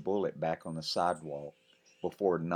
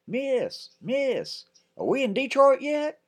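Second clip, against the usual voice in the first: high, loud shouted call; loud joking GI shout; soldier yelling; 8 dB louder than usual. Acted. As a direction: holler from a passing truck.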